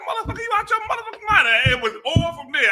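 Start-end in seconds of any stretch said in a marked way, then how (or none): 0.1-1.5 high, fast, laughing mock yell; hammed-up comedian rant, cracking up at his own line; Bernie Mac style yell. Retelling the supervisor clash, doing a laughing, high Bernie Mac rant. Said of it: "I think I did Bernie Mac on him".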